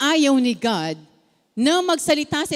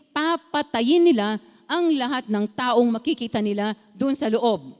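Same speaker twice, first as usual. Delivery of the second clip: ordinary talk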